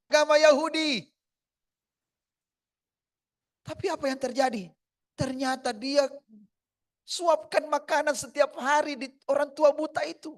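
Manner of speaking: high, loud, rising story cry; full-force preaching. Acted. Sermon story climax.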